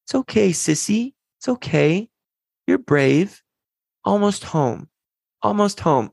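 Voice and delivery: lightly voiced little boy. Son comforting crying sister.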